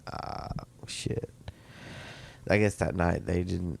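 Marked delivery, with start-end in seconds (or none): 0.1-1.3 whispered. Retold or imitated. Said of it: "And I was like".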